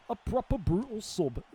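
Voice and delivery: a hint of a northern English accent, mocking. Teasing his friend again with a light northern accent. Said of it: "the most northern shit I've ever heard".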